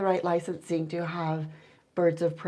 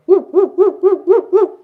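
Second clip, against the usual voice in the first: much higher, loud owl call; loud owl hoot; 11 semitones higher, 14 dB louder than usual. Shown as a demonstration. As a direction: belt out high, loud hoots. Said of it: "they mean different things".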